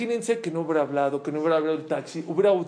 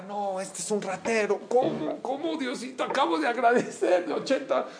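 high, agitated mock panic; shrill-free frantic complaint, pitch way up; frantic outrage; 7 semitones higher than usual. Woman complaining to God about the thief, frantic. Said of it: "Se puso como loca".

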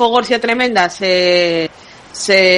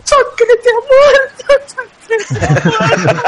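put-on wailing kid; 13 semitones higher than usual. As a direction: wail it like a distraught kid. Kid bursts in announcing death.

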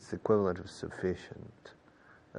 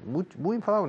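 mock very angry; 11 semitones higher, 5 dB louder, faster than usual. Shown as a demonstration. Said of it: "acts very angry".

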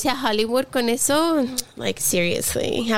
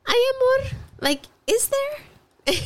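mock sweetheart voice; much slower than usual. Mock sweet wife voice to husband.

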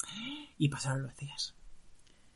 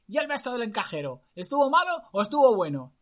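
high, louder mocking taunt; mocking prince; 9 semitones higher, 5 dB louder than usual. Prince taunts the girl about the kiss, mocking. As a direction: taunt her gleefully.

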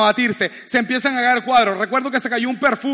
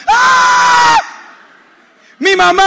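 loud high shriek, imitating a terrified wife; wife's panicked scream; 9 dB louder, much slower than usual. Husband mimics his wife in the earthquake, panicked scream. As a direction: scream in mock panic, very high, then cry out. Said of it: "mi esposa hace algo como esto".

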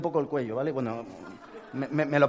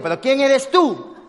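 louder, higher, slow demand at the intercom; heated demand; 10 semitones higher, 10 dB louder, slower than usual. Retold or imitated. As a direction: bark who are you into the intercom.

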